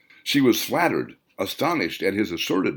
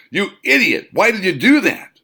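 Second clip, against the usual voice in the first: furious, much higher and louder shout; angry outburst; 11 semitones higher, 7 dB louder than usual. Acted. Protective man shouting at the drunk who bumped their table, furious. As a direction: yell at the clumsy drunk in sudden rage.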